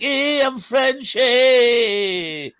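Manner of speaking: high, slow, hammed-up French Canadian "eh"; mock French Canadian accent. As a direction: lay the French Canadian accent on thick and shouted. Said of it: "he doesn't speak like a, like that".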